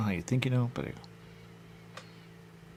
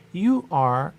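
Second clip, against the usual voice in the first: sudden loud quote; 9 semitones higher, 11 dB louder than usual. Retold or imitated. Retold quote, cut off.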